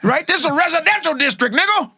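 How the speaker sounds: high comic Pryor rant; Richard Pryor impression. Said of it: "Richard Pryor".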